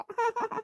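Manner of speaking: soft tearful laugh that breaks into crying; laughing through tears. Acted. Girl laugh-cries softly as uncle says goodbye. Laugh-cry after pleading.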